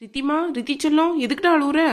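put-on high-pitched, fussing concern for an upset child; doting coaxing. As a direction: coax a crying little one with pet names. Grandmother coaxing a crying child.

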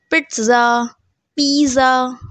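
पिज़्ज़ा with a buzzing z in place of ts; common mispronunciation. Shown as a demonstration. Teacher quoting how everyone says the word, mispronounced. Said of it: "बहुत कुछ बोलते हैं".

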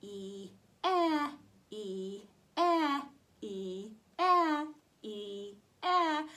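teacher drilling E sounds; long and short E. Shown as a demonstration. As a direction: alternate "E" and "eh". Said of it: "Here's E".

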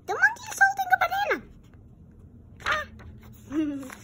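high, loud, very fast squeal; high excited squeal. Real, not acted. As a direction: blurt it out thrilled. Excited discovery in picture.

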